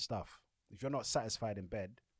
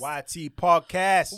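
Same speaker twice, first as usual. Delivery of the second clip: slow, loud, higher show-name shout-out; announcer plug; 9 semitones higher, 16 dB louder, much slower than usual. Acted. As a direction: boom the podcast name like a jingle.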